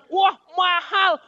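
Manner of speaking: loud, slow, high "Wah, mahal"; customer's shout. Retold or imitated. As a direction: blurt out that it's too pricey. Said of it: "Begitu orang bilang". (teriakan pelanggan yang kaget harganya)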